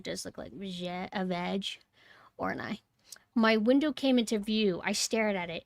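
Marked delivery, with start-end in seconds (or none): 0.0-2.8 hushed embarrassed whisper. Real, not acted. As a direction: drop to a whisper for the rude word. Embarrassed aside about vaginas.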